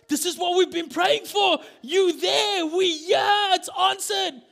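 high, loud overjoyed cry; overjoyed shout. Acted. Rhoda to herself on hearing Peter, overjoyed high shouting. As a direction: shout it like answered prayer.

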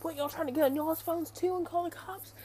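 high-pitched mock accusing question; retold indignant challenge, pitched well up; imitated paranoid accusation. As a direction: mimic someone rounding on the group. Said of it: "starting to think people were doing things".

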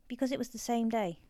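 ordinary delivery, nothing marked

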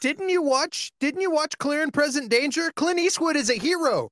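high-pitched, pleading disbelief; mock indignant protest. Mock protest defending a film star.

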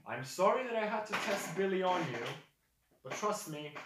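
high, loud, earnest character voice; projected in-character apology; apologetic figure. Acted.

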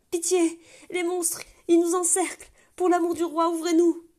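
high, loud, panicked cries through a door; desperate pleading shout. Acted. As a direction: beg to be let in, terrified. Gardien terrifié hurle derrière la porte. (gardien affolé qui supplie qu'on ouvre la porte, hurlant de terreur)

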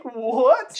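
loud, high, incredulous outburst; mock-shocked disbelief. Listener hearing about the AK forty-seven, exaggerated shock.

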